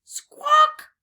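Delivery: very high, loud bird squawk; parrot squawk. A narrator's reading. Parrot's noise voiced in story.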